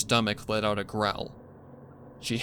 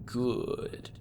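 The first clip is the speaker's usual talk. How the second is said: creepy villain voice; 5 semitones higher, slower than usual. Acted. Villain gloats over captive.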